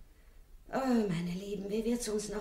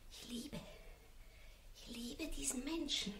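hushed, trembling admission; soft secret of love; whispered confession; 10 dB quieter than usual. Acted. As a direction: confide it in a whisper.

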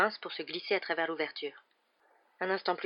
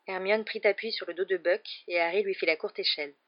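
ordinary delivery

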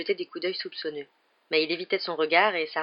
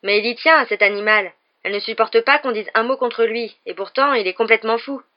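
louder, higher, indignant complaint; indignant and cross; angry; 4 semitones higher, 7 dB louder than usual. A narrator's reading. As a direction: keep grumbling in a raised, heated voice. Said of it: "dit Ron avec colère".

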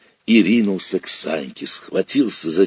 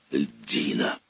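plain reading voice

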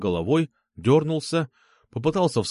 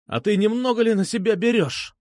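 high-pitched, mild mock yell; indignant yell; 8 semitones higher than usual. A narrator's reading. Vitya challenges the man pinning him, indignant yell. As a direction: protest hotly in a raised pitch. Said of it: "заорал Витя".

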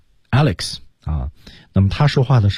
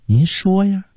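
friendly coaxing; 11 semitones higher, slower than usual. Shown as a demonstration. Teacher contrasting a friendly request. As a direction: ask like a good friend, not forcing. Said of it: "如果我们说好朋友这".

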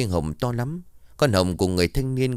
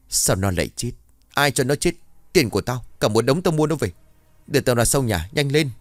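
louder, higher angry barking over lost money; furious rant; 5 semitones higher, 3 dB louder than usual. Acted. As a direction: rage at losing what he paid for. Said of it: "mặt đỏ phừng phừng đanh nghiến". (giận dữ quát tháo)

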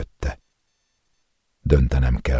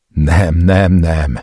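louder, higher repeated no; raging denial; 3 semitones higher, 6 dB louder, much slower than usual. A narrator's reading. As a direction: slam each no out in fury. Hears his brother died.

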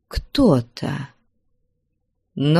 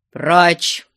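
single loud command; loud shout; 3 semitones higher, 5 dB louder than usual. A narrator's reading. Father pushes daughter away, loud stern shout. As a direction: drive them off with one shout. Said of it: "громко закричал Потап Максимыч".

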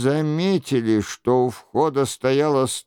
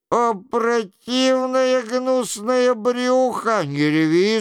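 exasperated cry; 8 semitones higher than usual. A narrator's reading. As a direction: cry out in disgust, then cut it short. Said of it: "закричал Шико". (раздражённый крик)

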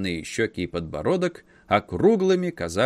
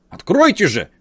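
loud, high shout; 8 semitones higher, 8 dB louder than usual. A narrator's reading. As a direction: yell it, pounding the door. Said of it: "крикнул дядя".

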